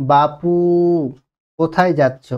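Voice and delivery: loud call with বাপু stretched; বাপু drawn out in a call. Shown as a demonstration. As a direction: call out, holding বাপু long. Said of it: "দেখো".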